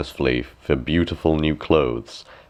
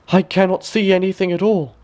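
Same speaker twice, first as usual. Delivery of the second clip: dismayed minister; 13 semitones higher, 4 dB louder than usual. A narrator's reading. Minister panicking inwardly.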